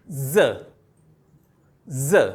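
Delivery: ज said alone, clear and emphatic; modelling the letter sound. Shown as a demonstration. Teacher repeating the new letter ज to pupils, emphatic. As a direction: sound out ज crisply for the class. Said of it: "तो ये बन जाएगा ज".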